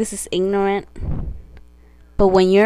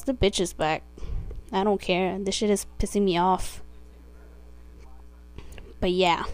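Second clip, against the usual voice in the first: normal talking voice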